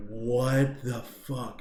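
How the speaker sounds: very slow, drawn-out amazement; acted-out slow astonishment; stunned disbelief. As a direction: stretch out each word in wonder. Retelling amazement that milk worked.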